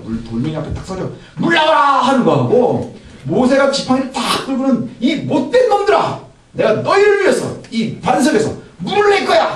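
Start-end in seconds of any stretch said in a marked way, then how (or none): 5.0-9.5 Moses scolding before striking; mock furious yell. Shown as a demonstration. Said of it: "한번 두 개를 상상을 해보세요".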